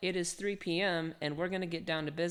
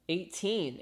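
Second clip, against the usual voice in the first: usual speaking voice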